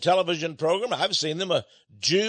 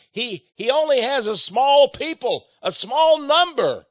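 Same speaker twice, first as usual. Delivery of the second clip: preached emphasis; 7 semitones higher, 5 dB louder than usual. Real, not acted.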